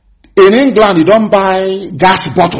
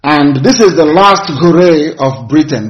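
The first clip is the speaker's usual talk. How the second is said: ordinary delivery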